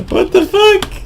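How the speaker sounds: high, incredulous yelp; startled disbelief. Real, not acted. Gamer startled by something on screen, high incredulous yelp. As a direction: blurt it out, caught off guard. Startled reaction during a game.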